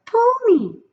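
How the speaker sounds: high, loud tempting little voice; pigtail voice. A narrator's reading. The pigtail coaxes Paul in a high little voice. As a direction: coax him like a tiny tempter. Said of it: "said the pigtail".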